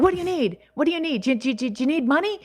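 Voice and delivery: high, lightly imitated pushy helper; eager embassy official. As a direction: rattle off the offers like an over-eager official. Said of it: "they're like".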